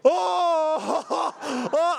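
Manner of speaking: high, loud mock screams; imitated panicked screaming. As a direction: act out yelling in panic. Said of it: "I'm, like, yelling and screaming".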